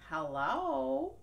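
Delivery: wolf pretending to be friendly; fake-nice wolf. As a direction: sweeten the voice to lure her. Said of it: "said the wolf, pretending to be nice".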